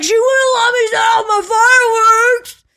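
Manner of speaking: high, loud crying whine; whiny crackhead. Retold or imitated. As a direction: wail it like a sulking child. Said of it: "That's exactly what he sounded like".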